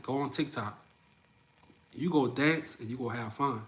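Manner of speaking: ordinary talk, nothing marked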